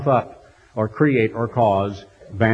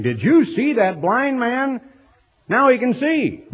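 higher, slower, amazed retelling; marveling crowd; 10 semitones higher than usual. Retold or imitated. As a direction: act out the crowd's astonishment.

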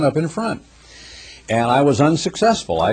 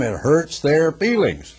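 ordinary delivery

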